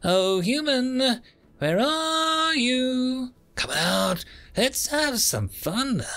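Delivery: high, drawn-out sing-out in a sugary voice; deceptively honeyed call. A narrator's reading. Taunting hunter calling to a hiding human, deceptively honeyed. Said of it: "a deceptively honeyed voice called out".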